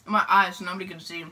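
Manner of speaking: normal talking voice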